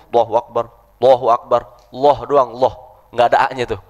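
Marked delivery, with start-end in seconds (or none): 0.1-1.6 "Allahu Akbar" hurried as "lohu akba", no A; rushed takbir. Retold or imitated. Teacher mocking a hurried worshipper's takbir. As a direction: gabble it like someone praying in a hurry. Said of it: "orang kan sholat buru-buru".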